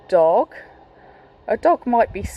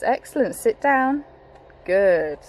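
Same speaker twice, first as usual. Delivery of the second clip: normal talking voice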